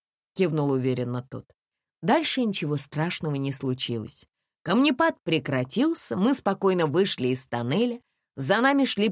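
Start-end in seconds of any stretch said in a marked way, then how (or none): none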